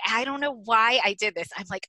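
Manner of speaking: ordinary talk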